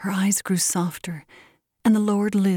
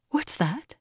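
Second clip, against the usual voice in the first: higher-pitched, mildly sharp query; sharp question; 5 semitones higher, 4 dB quieter than usual. A narrator's reading. Josefa questioning Givens about Bill, mildly sharp. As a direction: ask with a touch of sharpness. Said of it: "asked Josefa sharply".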